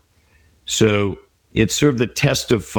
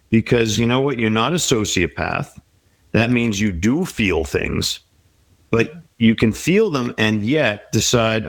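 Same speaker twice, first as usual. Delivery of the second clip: ordinary talk, nothing marked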